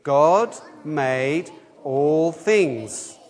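very slow, every word spaced for all to join; slow recitation. Shown as a demonstration. Leading children and adults in saying it together, very slow. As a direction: lead the group, stretching each word. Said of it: "Do you wanna say it with me?".

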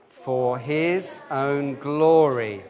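very slow, every word spaced for all to join; slow recitation. Shown as a demonstration. Leading children and adults in saying it together, very slow. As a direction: lead the group, stretching each word. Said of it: "You wanna say it with me?".

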